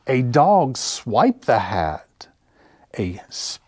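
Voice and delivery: ordinary delivery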